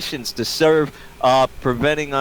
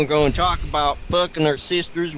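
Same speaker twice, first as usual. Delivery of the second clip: put-on hillbilly accent. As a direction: play a backwoods podcast host. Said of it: "it's just a funny voice".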